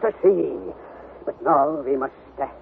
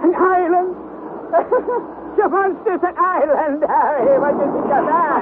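delighted laughing; 9 semitones higher than usual. Acted. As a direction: gloat with amused glee. Uncle delighted by island.

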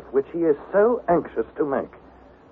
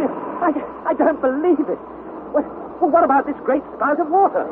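high, stammering astonishment; can't believe what he hears; stammering disbelief; 11 semitones higher than usual. Acted. Harry astonished.

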